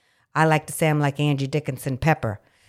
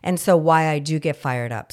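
ordinary talk, nothing marked

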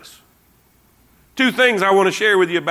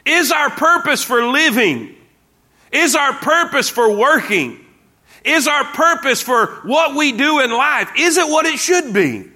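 higher preaching cadence; 6 semitones higher than usual. Real, not acted. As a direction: preach it with rising fervor. Preaching at full force.

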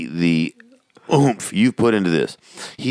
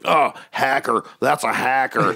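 higher, hammed-up indignant groan; overdone kid's accusation; 7 semitones higher, slower than usual. Son watching dad lose online, overdone accusing. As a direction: overdo the mock outrage, pitched up. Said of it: "so he overdoes it".

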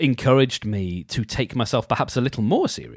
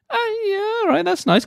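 mock approval. Real, not acted. Ironic reaction to the reading.

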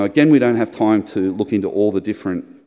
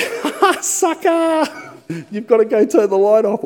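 high, loud, laughing taunt over a lost game; gloating laugh; 14 semitones higher, 6 dB louder, slower than usual. Retold or imitated. Husband gloating to wife over paper scissors rock, high laughing taunt. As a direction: crow at your wife like a giddy winner. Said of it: "I'm like".

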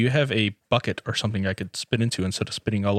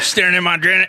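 louder and higher mock accusation; teasing accusation; 7 semitones higher, 5 dB louder than usual. Friend teasing the host about his Janet, louder and higher mock accusation. Teasing repeat of the same line.

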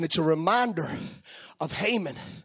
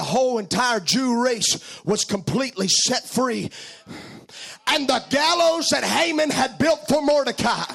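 preaching; 10 semitones higher than usual. Acted. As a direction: preach it out, full force. Preaching at full force, curse.

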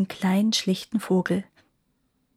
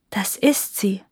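ordinary delivery

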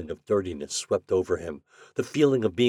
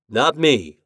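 flat; 6 semitones higher, 8 dB louder than usual. Acted. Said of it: "said Shandor flatly".

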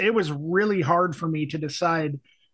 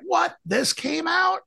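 sharply higher astonished question; shocked it even came out; surprised; 11 semitones higher, slower than usual. Retold or imitated. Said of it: "And I'm just like".

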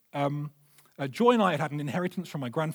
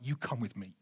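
soft firm invitation; 7 semitones lower, 11 dB quieter than usual. Retold or imitated. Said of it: "I'm like".

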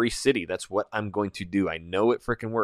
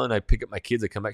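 usual speaking voice

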